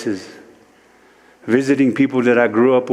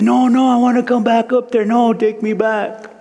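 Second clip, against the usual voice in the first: high pleading, slowing at the end; 13 semitones higher than usual. Retold or imitated. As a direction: beg to be taken back up, drawing it out. Pleading to return to clouds.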